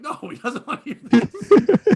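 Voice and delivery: slow, loud laughing reply; laughing retort. Retold or imitated. Host mocks drunk friend's message, laughing loudly. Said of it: "I'm like".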